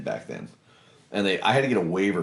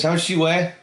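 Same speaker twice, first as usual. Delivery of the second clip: raised, higher gruff recruiter question; lightly hammed-up recruiter, louder and higher; mock recruiter; 9 semitones higher, 5 dB louder than usual. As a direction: needle the kid about his weight. Gruff recruiter sizing him up.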